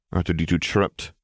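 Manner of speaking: plain reading voice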